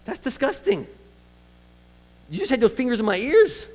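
disgusted guy. Retold or imitated. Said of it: "if you're this guy, like".

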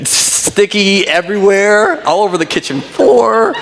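high, laughing exasperation; amused at the mess. Real, not acted. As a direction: laugh through it. Laughing about apple mess.

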